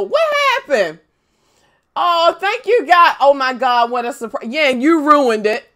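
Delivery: usual speaking voice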